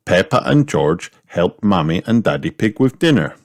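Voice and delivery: ordinary delivery, nothing marked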